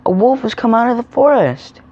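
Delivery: high-pitched acted alarm cry; shouted alarm. A narrator's reading. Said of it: "he shouted".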